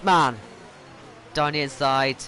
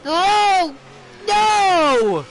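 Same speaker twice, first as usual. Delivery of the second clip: very high, loud yell of no; real shout of dismay; anguished yell; 9 dB louder than usual. Real, not acted. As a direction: scream it as you're hit. Car hit from behind.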